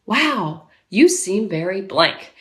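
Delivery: low, slow, even delivery; calm validating parent. Shown as a demonstration. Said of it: "you're talking just like this".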